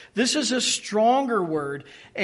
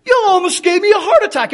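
loud, high, rushed guilt trip; hammed-up mother clutching her heart; overwrought mom; 10 semitones higher, 12 dB louder, faster than usual. Mom to her child after a fright, melodramatically reproachful. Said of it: "my mom would say to me often".

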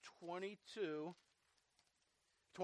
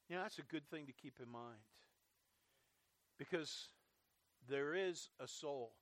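usual speaking voice

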